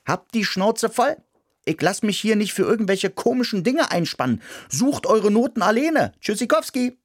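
high, cheeky little mouse; defiant mouse. Acted. Mouse quits the job.

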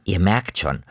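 plain reading voice

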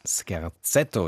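false starts before landing on Scherzetto; stumbling over a word. Real, not acted. Radio host announcing a piece to listeners, tongue-tied stumble. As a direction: trip on the title, then get it. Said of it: "jetzt hab ich's".

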